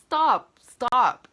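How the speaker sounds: loud, high, cutting stop; firm stop. Retold or imitated. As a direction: shut her down hard. Cutting off the director.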